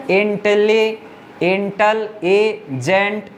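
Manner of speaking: Intelligent said very slowly, broken into its syllables. Shown as a demonstration. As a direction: stretch out Intelli- then intelligent piece by piece. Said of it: "ऐसे दो तीन step में हो रहा है". (Intelligent को धीरे-धीरे टुकड़ों में तोड़कर बोलना)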